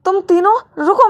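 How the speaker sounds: put-on high child's voice calling them to stop; a little girl shouting to make them wait. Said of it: "मैंने कहा".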